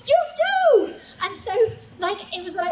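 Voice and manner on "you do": high, loud, eager cheering voice; eager puppy hype men. Retold or imitated. The boys cheering Suze on like puppies, high and loud. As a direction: gush like an overexcited puppy. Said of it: "they're like".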